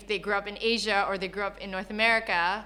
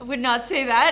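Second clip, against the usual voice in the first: laughing denial; 4 semitones higher, 10 dB louder than usual. Real, not acted. Laughing denial after joke.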